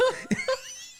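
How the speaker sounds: high burst of laughter; real laughter breaking out. Real, not acted. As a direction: crack up mid-story. Laughing at the memory.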